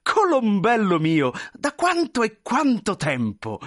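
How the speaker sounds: high, slow, effusive greeting; drawn-out cries of joyful recognition; delighted exclamation. Acted. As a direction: gush over a long-lost friend. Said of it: "esclamò il grosso, scorgendo lo smilzo".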